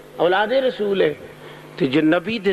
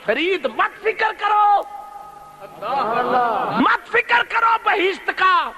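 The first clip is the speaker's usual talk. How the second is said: voice from grave; 10 semitones higher than usual. Acted. Saint in the grave tells Farid not to worry, proclaimed high. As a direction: call out the reassurance in a raised, sustained preaching pitch. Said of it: "پھر قبر چو آواز آئی".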